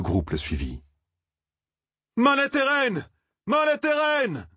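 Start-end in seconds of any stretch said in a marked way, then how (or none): 2.2-4.5 high, ringing battle cry; shouted war cry. A narrator's reading. Young man charges with drawn sword, shouting a war cry. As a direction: cry it out high, stretching the word. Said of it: "cria le jeune homme".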